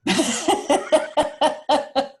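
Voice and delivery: a real burst of laughter; amused laugh. Real, not acted. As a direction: laugh out at the joke. Laughs at Canada remark.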